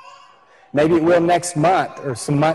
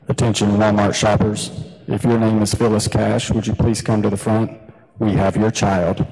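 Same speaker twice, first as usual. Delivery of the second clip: lower, formal loudspeaker page; store PA announcement; 6 semitones lower than usual. Retold or imitated. Store clerk paging the mother over the PA, formal low announcer. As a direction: read it like a bored store intercom.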